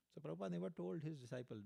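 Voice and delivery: ordinary delivery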